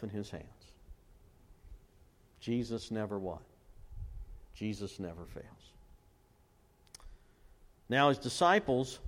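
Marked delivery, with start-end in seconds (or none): none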